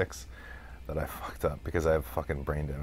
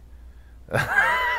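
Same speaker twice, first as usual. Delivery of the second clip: high squealing laugh; 4 dB louder than usual. Real, not acted. Laughs at dyslexia joke.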